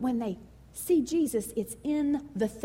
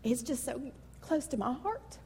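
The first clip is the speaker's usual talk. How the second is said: softer, near-whispered, choking back emotion; fighting tears; 10 dB quieter than usual. Real, not acted. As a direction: pastor holding back tears before congregation, hushed. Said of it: "I really told God I don't wanna cry".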